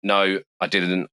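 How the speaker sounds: normal talking voice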